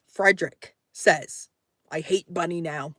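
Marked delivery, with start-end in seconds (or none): 1.9-2.9 whispered quote. Retold or imitated. Reading a chat message aloud.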